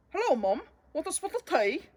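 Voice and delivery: high, chirpy mock British accent; a little boy showing off his British accent; imitating British accent. Bodhi imitates his cousin's British accent for his brothers. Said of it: "trying out his best imitation of their cousin".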